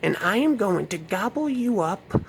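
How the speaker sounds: whisper-taunt like a devouring witch; Salem witch. Acted. Witch voice again.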